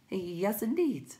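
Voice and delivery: high, small creature voice; timid little creature. A narrator's reading. Will-o'-the-Wisp answers the Tiny, high timid voice.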